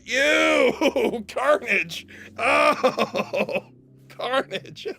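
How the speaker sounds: high, loud "ew!" of disgust; disgusted shriek. Real, not acted. Streamer grossed out by his own words, shrieking. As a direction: squeal it out in real disgust.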